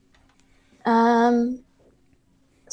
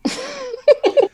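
bursts out in a high laugh; high delighted laugh. Real, not acted. Laughing at the song.

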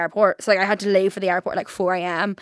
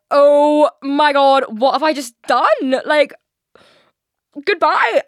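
panicked; 5 semitones higher, 6 dB louder, much slower than usual. Retold or imitated. Retelling her panicked exit.

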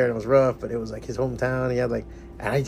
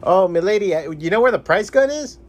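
high, louder put-on courtly address; mock gallant; 6 semitones higher, 4 dB louder than usual. Asking milady where the price gun is, mock gallant. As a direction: call out to milady in a raised, playful voice.

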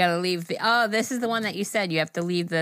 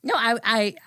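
ordinary talk, nothing marked

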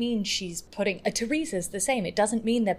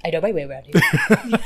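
laughing echo; 7 dB louder than usual. Retold or imitated. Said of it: "watch Vicky Gunvalson in Sunset Boulevard".

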